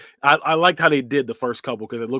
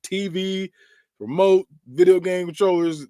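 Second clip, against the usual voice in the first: ordinary delivery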